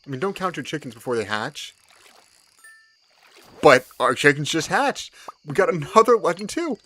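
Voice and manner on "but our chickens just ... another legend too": excited. Real, not acted. Another legend fish caught.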